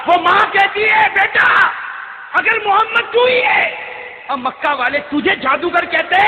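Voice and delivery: high shouted declamation; full-force preaching. Acted. Old mother defending her son against Makkah, fervent shouting. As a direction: cry it out to the crowd.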